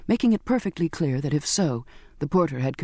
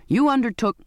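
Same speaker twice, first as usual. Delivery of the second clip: raised high, a mild indignant cry; indignant, cut off; 7 semitones higher, 3 dB louder than usual. A narrator's reading. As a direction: protest sharply, then get cut off. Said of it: "cried with indignation".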